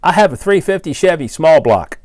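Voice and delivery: mock caller. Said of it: "when you call up and say".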